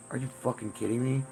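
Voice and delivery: ordinary delivery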